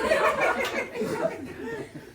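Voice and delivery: high burst of laughter; shrieking laugh. Real, not acted. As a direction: crack up laughing. Laughing at own pun.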